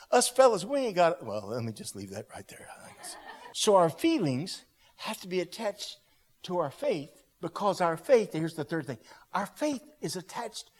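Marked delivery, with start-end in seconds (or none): none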